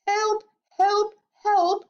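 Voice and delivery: high cries for help; drowning boy's high yelps; crying out. A narrator's reading. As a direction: call out desperately up high. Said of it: "cried Augustus".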